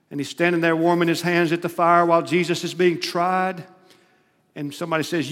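ordinary delivery

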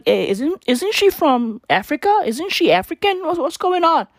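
high, baffled questioning; puzzled disbelief. Retold or imitated. Reacting to news of a faked African accent, puzzled disbelief. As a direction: pitch it up, confused and incredulous.

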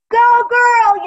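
high, loud cheer for a passing runner; cheering college girls. Retold or imitated. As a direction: shout it like a cheering fan at the roadside.

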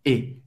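"E" with an open E sound. Shown as a demonstration. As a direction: open up the vowel on a low, soft "E". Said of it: "tem o som do E aberto". ("E" com som aberto)

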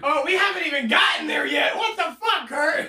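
loud, high-pitched disbelief; incredulous outburst. Real, not acted. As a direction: shout it in amused outrage. Loud incredulous reaction to more story.

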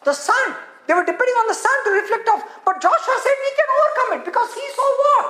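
very high, urgent preacher delivery; forceful preaching. Real, not acted. Preacher quizzing congregation about Joshua's faith, excited and forceful. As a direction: build excitedly to a ringing question.

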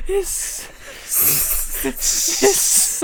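long, drawn-out hiss; mock hissing. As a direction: hiss like the owl man.

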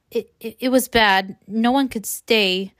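stammered, hesitant start; halting. Acted. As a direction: stumble over the first word, then drag it out. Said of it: "she says hal-, haltingly".

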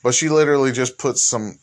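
usual speaking voice

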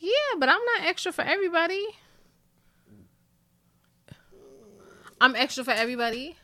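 fast, high, protesting rise; quick mock-offended retort; indignant questioning. Real, not acted. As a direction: fire it back in mock outrage. Playful quarrel with boyfriend.

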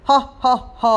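loud, big laugh from the sky; booming sky laugh. Acted. Sky laughs hugely at the little doll.

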